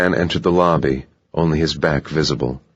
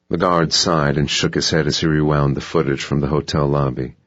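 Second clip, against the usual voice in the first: ordinary delivery, nothing marked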